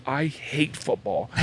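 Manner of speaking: soft, slow mock sulk of a hurt kid; retold sulky despair. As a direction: draw it out quietly, imitating a miserable son.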